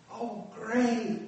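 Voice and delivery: high, dismayed "Oh, great"; sudden sinking realization. Retold or imitated.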